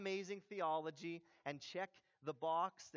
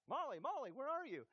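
higher sing-out call for a wife; mock calling out; 6 semitones higher than usual. Husband calling for his wife at home, mock calling out. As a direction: pretend to call her from another room.

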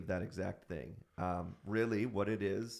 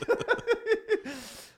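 big laugh; 6 dB louder than usual. Real, not acted. Laughing at son's catching.

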